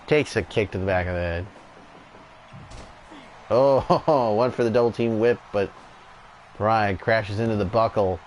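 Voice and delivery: steady wrestling commentator call; live play-by-play. Acted. Commentator calling the tag match for viewers, play-by-play. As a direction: call each move as it lands.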